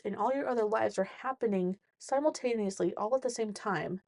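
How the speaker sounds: ordinary delivery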